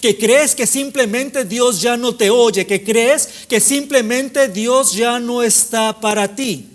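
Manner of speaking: driving, high-energy sermon delivery; impassioned preaching. Real, not acted. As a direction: preach it in insistent, rolling repetitions. Preaches at full force.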